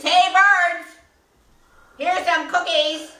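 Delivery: high, full-volume calling out; loud shout. A narrator's reading. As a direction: holler it out to the birds. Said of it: "he shouted in a loud voice".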